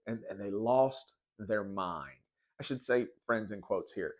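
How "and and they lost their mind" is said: normal talking voice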